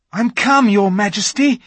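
put-on high, eager boy's voice; a boy hurrying to please; eager young Edmund. A narrator's reading. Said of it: "rushing eagerly forward".

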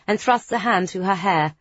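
ordinary delivery

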